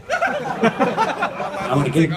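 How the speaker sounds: put-on slow, high, childish whine; host voicing a goalkeeper who wants to kick, slow and high; mock goalkeeper. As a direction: whine like a bored keeper.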